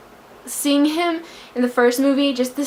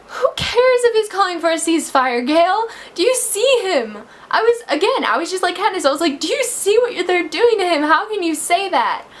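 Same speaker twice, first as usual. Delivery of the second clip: emotional screaming; 8 semitones higher than usual. Real, not acted. Upset fan yelling at Gale over Peeta, tearful shout. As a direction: shout at Gale through tears. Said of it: "I'm actually screaming and getting so emotional".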